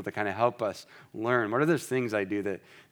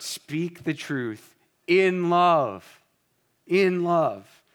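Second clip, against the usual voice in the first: very slow, higher and louder, leaning on in love; emphatic; 6 semitones higher, 4 dB louder, much slower than usual. Shown as a demonstration. Pastor urging truth-speakers to do it in love, emphatic and slow. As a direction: hammer home each word. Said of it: "let this phrase ring in your heart".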